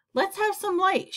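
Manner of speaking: high-pitched call, only mildly shouted. A narrator's reading. Centipede to the others in the dark, shouted. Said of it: "shouted the centipede".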